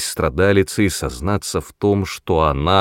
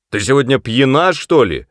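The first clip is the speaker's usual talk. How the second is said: loud, high angry yell; 6 semitones higher, 5 dB louder than usual. Acted. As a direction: bellow at his wife. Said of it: "заорал он на неё".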